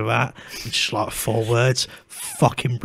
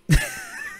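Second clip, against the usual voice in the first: high squeaky laugh. Real, not acted. Laugh after mess remark.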